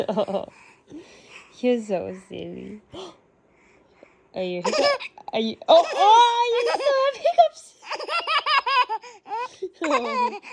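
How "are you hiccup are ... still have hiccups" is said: very high, loud laughing coo. Real, not acted. Mom teasing hiccuping baby.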